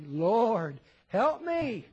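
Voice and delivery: acted cry for help; pleading prayer. Acts out a desperate prayer.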